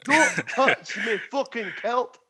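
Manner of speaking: high, slow mock Scottish accent. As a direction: put on an irate Scottish accent. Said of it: "not gonna attempt that accent".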